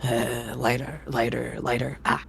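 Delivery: absent-minded mutter. Acted. Ringmaster fumbling.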